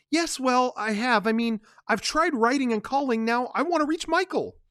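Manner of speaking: put-on a young woman desperate to reach her man; pleading Kay.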